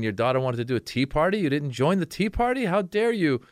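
mock outrage. Comedian mocking parent-shamers with high mock outrage. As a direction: scold like a shocked onlooker.

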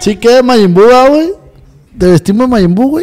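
loud, high, teasing question; insistent shouting. Real, not acted. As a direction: push the idea loudly over his protest. Pushing costume over friend's protest.

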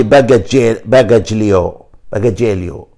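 slow, loud mock-Italian باجاجليو; Italian-sounding باجاجليو, stretched and loud; Italian pronunciation. Shown as a demonstration. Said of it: "بالإيطالي".

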